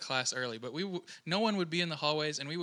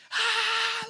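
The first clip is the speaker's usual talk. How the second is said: mock scream; 6 dB louder than usual. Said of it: "like, scream".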